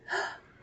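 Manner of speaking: gasp. Acted. Bear recognizing Goldilocks.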